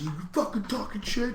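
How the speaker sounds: high-pitched mock raging trash talk; imitated angry taunting. Winner getting in the beaten opponent's face, mock angry taunt. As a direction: rant it high, mimicking an enraged fighter. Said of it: "he gets in Frank Mir's face".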